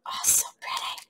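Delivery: hushed whisper of admiration; soft adoring whisper; whispered awe. Real, not acted. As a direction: breathe it out softly in awe. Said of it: "trying not to scream in your ear".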